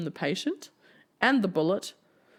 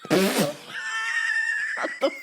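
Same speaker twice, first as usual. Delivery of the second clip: high, drawn-out laughing outcry; laughing shout; much slower than usual. Real, not acted. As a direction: stretch it out while laughing. Reacting to absurd question.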